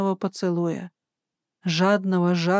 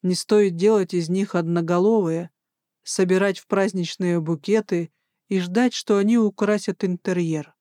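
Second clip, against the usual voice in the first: ordinary delivery, nothing marked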